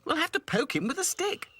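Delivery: high squeaky doll. Acted. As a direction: chirp it like a little doll. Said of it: "said Dotty".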